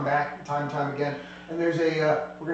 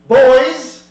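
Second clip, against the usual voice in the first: loud, high call for her guards; shouted summons; 8 semitones higher, 15 dB louder than usual. Acted. Dragon lady calling her guards out with a loud, high shout. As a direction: bark it out to bring the goons running.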